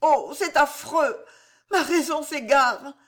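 high, distraught cry of horror; horrified wife. Acted. Jeanne, horrified, to the old constable: high distraught plea. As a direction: her mind slipping, pleading.

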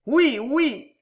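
high, loud, uneasy assent; pained eagerness. A narrator's reading. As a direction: agree through a pained smile. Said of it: "avec un sourire qui faisait mal à voir".

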